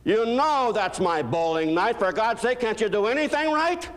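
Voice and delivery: husband berates wife over his bowling night, hammed-up outrage; mock outrage. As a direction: whine it like the world owes you. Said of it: "husband berates wife".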